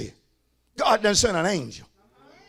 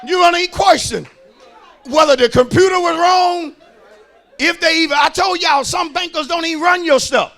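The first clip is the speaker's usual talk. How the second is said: loud, emphatic sermon delivery; full-force preaching; 6 semitones higher, 8 dB louder than usual. Acted. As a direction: drive each phrase home to the congregation.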